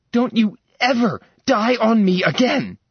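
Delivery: high, loud mock scolding; joyful scolding shout. Sarah scolding Max who came back alive, loud and high. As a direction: shout it at him in relief.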